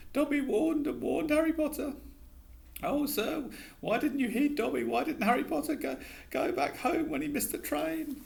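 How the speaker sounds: high squeaky elf voice, anxious; a fretful little elf begging; pleading house elf. A narrator's reading.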